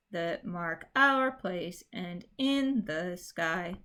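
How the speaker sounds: slow iambic reading, "our" leaned on. Shown as a demonstration. Teacher reading the line as she marked it. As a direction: read it as marked, steady beat. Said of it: "the way I have it marked".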